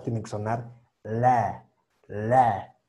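"la" with a thick, dark L. Shown as a demonstration. Teacher demonstrates a thick L sound. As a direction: say "la" with a fat, heavy L. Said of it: "una L gordita".

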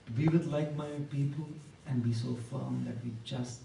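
low, soft, spaced phrases; steady them quietly, pausing between phrases; hushed encouragement. Real, not acted. Preacher urging audience to be strong, quiet and earnest.

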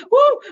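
very high whoop, then a short laugh; surprised whoop and laugh. Shown as a demonstration. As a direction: whoop as if someone jumped at you. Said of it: "the surprise laugh".

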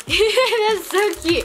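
cooing. Real, not acted. Cooing over tiny crisps.

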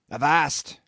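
sharp loud call of Avast; cried out. A narrator's reading. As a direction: bark it out to stop them. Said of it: "cried a voice".

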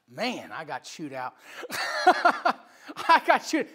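laughing through the words; breaking up while telling it on himself. Real, not acted. Preacher recalling getting chewed out, laughing.

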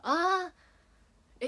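high, loud sudden realization; surprised cry. Real, not acted. Idol realizing her dialect slipped out, loud surprised cry. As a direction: caught out, cry out in dismay.

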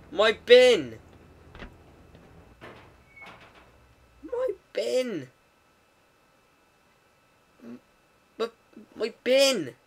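high, drawn-out mock wail; mock anguish. Player mourning his bin, mock anguished cry.

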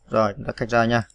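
ordinary delivery

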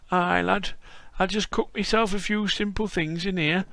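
put-on old Yorkshire grandad greeting a visitor; frail old Yorkshireman. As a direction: play a creaky old Yorkshire lad-caller. Voicing old Yorkshire Bob.

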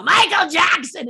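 drawn-out, high witch's holler; loud calling out. Retold or imitated. Witch rounds the corner at the star, loud high holler. Witch calls out to startle Michael.

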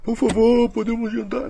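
high-pitched mock begging; pleading for permission. Shown as a demonstration. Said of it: "sugestão foi uma permissão".

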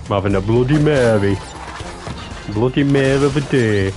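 ordinary talk, nothing marked

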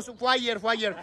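usual speaking voice

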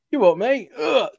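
high, cocky "You what, mate?"; cheeky British challenge. Retold or imitated. Brit teasing a tiny guy squaring up to a Wookiee, cocky London lad. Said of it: "and just to be like".

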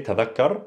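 "tadhakkar" with a voiced th, doubled k; careful Fusha "tadhakkar", dh as in English "the"; MSA pronunciation. Shown as a demonstration. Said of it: "This is an M S A".

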